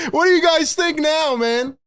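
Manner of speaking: high, gleeful crow to the viewers; laughing. Real, not acted. Streamer taunts viewers after the three, laughing. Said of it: "The only reason I'm laughing".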